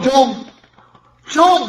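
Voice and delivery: high desperate calls; frantic calling. Acted. Boy waking from a nightmare, calling his uncle frantically. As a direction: cry out for him in fear.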